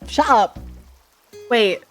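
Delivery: ordinary delivery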